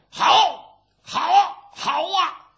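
high, loud crowd cheers; 喝彩吼叫. A narrator's reading. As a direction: bellow approval from the sidelines. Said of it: "跟着吼". (高声叫好)

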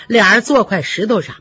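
ordinary delivery

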